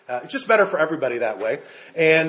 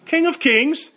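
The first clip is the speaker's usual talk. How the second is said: high, mock-British incredulous question; British accent; 10 semitones higher, slower than usual. God looking down on Xerxes, scoffing in a British accent. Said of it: "God had a British accent there".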